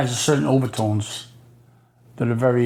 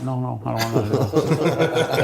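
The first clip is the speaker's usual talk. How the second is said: laughing refusal; slower than usual. Retold or imitated. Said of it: "You wanna slap me in the mouth?".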